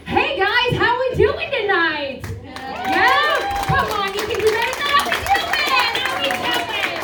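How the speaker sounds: high, loud emcee call to the crowd; hyped crowd greeting. Real, not acted. Comic hyping the audience at the mic, loud and high. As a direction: pump up the room, voice way up.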